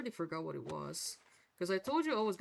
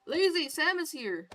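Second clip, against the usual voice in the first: excited; 10 semitones higher, 9 dB louder than usual. Real, not acted. Greeting arriving friend excitedly.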